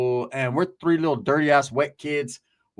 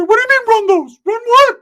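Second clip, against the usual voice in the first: high, loud outraged squawk; indignant yell; 12 dB louder than usual. Retold or imitated. Friend challenging a would-be robber, indignant high yell. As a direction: yell it back in high-pitched disbelief. Ricky protests the soda robbery.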